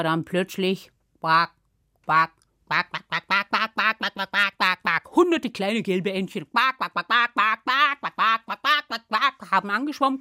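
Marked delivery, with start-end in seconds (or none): 1.2-5.2 rapid run of low imitated quacks; duck quacking. Ducks swim up answering the spell, quacking. 6.5-9.5 quick chattering imitated quacks; duck quacking. Hundreds of yellow ducklings arrive, quacking. Imitated flock of little ducks.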